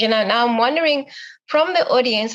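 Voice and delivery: ordinary delivery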